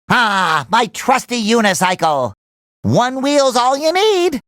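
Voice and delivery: ordinary delivery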